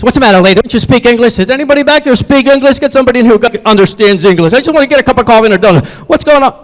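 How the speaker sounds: high, loud, angry rant; acted-out hostile, impatient shouting; mean adult bully. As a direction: berate the counter worker at full volume. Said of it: "he's being really mean and hurtful".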